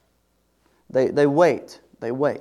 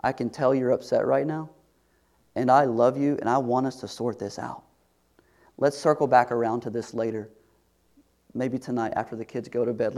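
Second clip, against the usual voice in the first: softer, lower, tender voice; gentle reassurance; 4 semitones lower, 8 dB quieter, faster than usual. Shown as a demonstration. Scene: husband calming upset wife, soft and tender. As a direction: soothe an upset spouse. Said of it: "what if you did something like this?".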